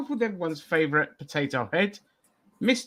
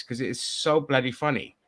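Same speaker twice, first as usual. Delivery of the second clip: ordinary delivery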